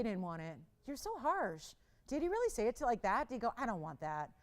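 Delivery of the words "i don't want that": ordinary talk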